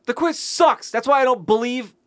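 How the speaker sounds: high-pitched indignant complaint; tantrum. Real, not acted. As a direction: rail against the quiz. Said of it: "is throwing a tantrum".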